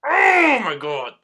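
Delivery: slow, high drawn-out exasperation; exasperated groan. Real, not acted. Fed up with the labels, drawn out and high. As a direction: stretch it out, fed up. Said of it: "Pisses me the fuck off".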